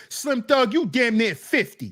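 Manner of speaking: ordinary talk, nothing marked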